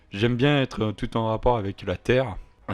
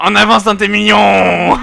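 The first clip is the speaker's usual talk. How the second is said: war cry; 8 semitones higher, 12 dB louder than usual. Acted. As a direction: bellow it like charging into battle. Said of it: "un cri de guerre". (cri de guerre hurlé en riant)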